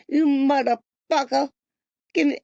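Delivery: loud mock yell at someone; hammed-up taunt in a loud voice; mocking toothless voice. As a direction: sneer it like a bully mocking. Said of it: "I'd be like".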